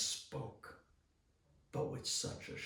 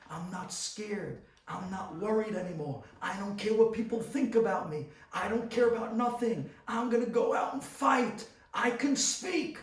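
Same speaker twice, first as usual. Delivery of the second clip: rising, higher and louder yell; screaming; 9 semitones higher, 11 dB louder than usual. Retold or imitated. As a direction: build the scream to the top. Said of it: "The kid was screaming".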